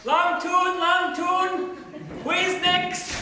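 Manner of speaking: high, loud recruiter's call. Acted. Recruiter calling for volunteers, loud barker call. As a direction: cry out to the crowd like a barker.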